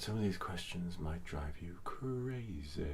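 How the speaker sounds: soft, slow, half-whispered aside; hushed mock calm. After a breathing exercise for maddening questions, hushed mock calm. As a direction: let it sink into a slow near-whisper.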